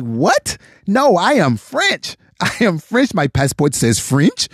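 bad French accent, mock disbelief; dumbfounded French accent. Frenchman insisting his passport says French, in a dumbfounded fake accent. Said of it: "he was just dumbfounded".

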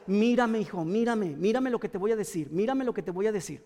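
soft, low, coaxing repetition; patient, insistent aunt. Retold or imitated. Aunt holding a small boy's face, quietly insistent. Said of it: "le agarraba la carita y le decía".

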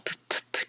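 プップップッ as short bursts of P with no vowel; bare P puffs on プップップッ; plosive consonant sound. Shown as a demonstration. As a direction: pop out プップップッ, lips only, each one separate. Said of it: "破裂音なんですけれど".

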